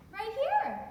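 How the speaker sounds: high, quick rise on HERE; puzzled retort. Acted. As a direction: say "Right here" as if it were obvious. Startled woman answers lover in bed.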